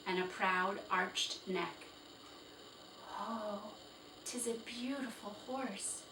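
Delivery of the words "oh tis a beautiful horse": soft, hushed awe; awed whisper. Acted. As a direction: breathe it in wonder. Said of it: "whispered Felicity".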